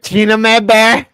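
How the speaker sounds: big, slow, drawn-out announcer call of her name; hyped slow call-out. Acted. Streamer introducing the clip woman to chat, slow booming call-out.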